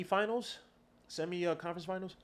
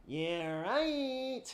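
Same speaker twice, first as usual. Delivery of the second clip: sarcastic scoff; 10 semitones higher than usual. Pretend. Sarcastic dismissal repeated.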